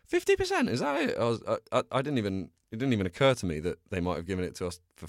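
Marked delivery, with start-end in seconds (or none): 0.0-1.2 very high mock-indignant voice; squeaky imitation of disbelief; incredulous onlookers. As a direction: mimic unimpressed people, pitch way up. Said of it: "people keep going".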